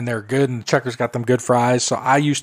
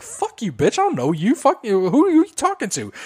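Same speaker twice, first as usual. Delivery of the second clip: high-pitched imitation of an indignant cashier; retold confrontational trash talk, pitch climbing; 9 semitones higher than usual. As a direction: imitate her sassing right back in a quarrel.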